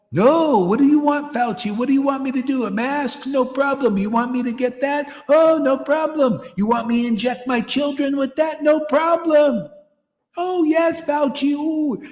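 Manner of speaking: high, loud, sing-song mock of an eager believer; mocking gullible follower. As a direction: ham up a naive yes-man, cooing at Fauci. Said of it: "But people".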